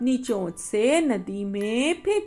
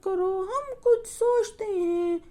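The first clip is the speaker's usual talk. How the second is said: bird reassuring; 7 semitones higher than usual. Acted. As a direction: comfort him gently in a high little voice. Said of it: "नीलो चिड़िया कहने लगी".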